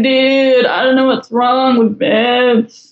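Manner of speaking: slow, spaced-out stoner drawl; lazy stoned dude voice. Retold or imitated. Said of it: "but with a stoner voice".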